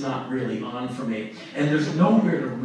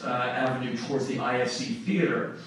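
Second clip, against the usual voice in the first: ordinary talk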